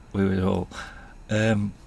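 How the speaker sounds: usual speaking voice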